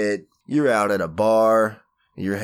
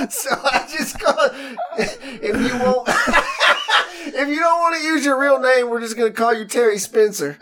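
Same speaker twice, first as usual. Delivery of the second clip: higher-pitched talk broken by real laughter; laughing through words; 13 semitones higher, 4 dB louder than usual. Real, not acted. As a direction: try to explain but keep cracking up. Laughing while explaining to Terry.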